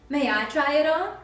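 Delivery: eager request. Acted. Cinderella eager to try slipper.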